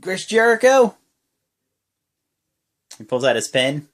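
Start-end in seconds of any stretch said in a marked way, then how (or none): 0.0-1.0 high, loud, mocking wrestler voice; Jericho impression. Retelling Jericho scolding his former friend, hammed-up high taunt. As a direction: ham up Jericho's taunting much higher. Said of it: "He's like".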